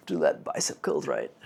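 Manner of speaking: low, loud gym-bro delivery; deep bro voice. Shown as a demonstration. Vlogger redoing his line for the camera, low loud gym-bro boom. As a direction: boom it out like a lifting coach. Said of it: "All right, let me".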